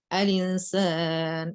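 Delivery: al-insan drawn out very slowly, syllable by syllable; slow naql recitation. Shown as a demonstration. Teacher recites al-insan slowly for students, drawn out. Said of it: "Itu kalau, eh, nakal".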